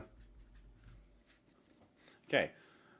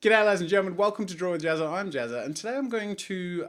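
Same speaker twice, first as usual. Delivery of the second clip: higher, louder upbeat host greeting; show intro; 4 semitones higher, 4 dB louder, faster than usual. Shown as a demonstration. Vlogger greets viewers in an upbeat, louder host voice. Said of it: "let's start again".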